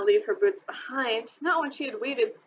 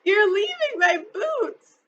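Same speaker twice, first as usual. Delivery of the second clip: slow, high, loud protest; girl protesting as she's lifted away; upset cry; 10 semitones higher, 5 dB louder than usual. A narrator's reading. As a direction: plead in distress, dragging it out. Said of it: "she cried".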